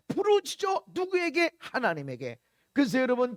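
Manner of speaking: normal talking voice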